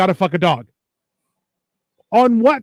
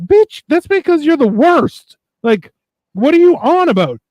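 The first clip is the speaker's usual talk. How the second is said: high-pitched, exasperated telling-off; mock outrage, pitched way up; indignant reply; 8 semitones higher than usual. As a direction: scold them in mock disbelief. Acted indignant reply to a confession.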